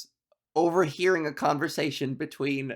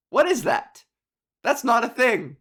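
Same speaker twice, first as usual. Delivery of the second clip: higher-pitched incredulous dad; acted bewildered protest; mock disbelief; 6 semitones higher, 3 dB louder than usual. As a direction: object as if baffled by nonsense, lightly hammed-up. Acts dad's disbelief at made-up term.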